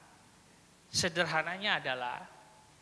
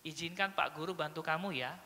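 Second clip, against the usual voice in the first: soft, lower reassuring voice; gentle offer; 4 semitones lower, 8 dB quieter than usual. Shown as a demonstration. Male teacher offering help to a crying child, gently. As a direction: offer help softly and calmly.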